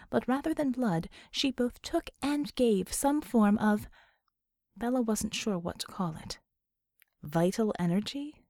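ordinary delivery, nothing marked

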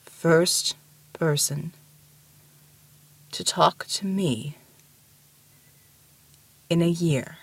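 slow, halting giant voice; long gaps, words like shifting boulders; mountain giant. A narrator's reading. The lonely giant explaining to Ramona, slow deep voice.

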